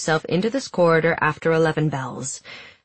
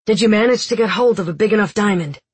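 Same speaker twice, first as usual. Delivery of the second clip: fast, higher eager voice; eager young man; 4 semitones higher, 5 dB louder, faster than usual. Acted. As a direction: rattle the question off. Jesper eager rushed question.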